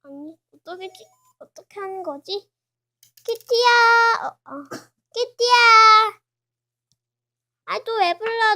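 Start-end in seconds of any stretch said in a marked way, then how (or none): 3.3-4.2 loud high call across the room; shouted call to Kitty. Acted. Calling out to Kitty. 5.1-6.2 loud high call across the room; shouted call to Kitty. Acted. Calling out to Kitty again. 7.7-8.6 louder, higher irritated reply; irritated loud answer; annoyed. Acted.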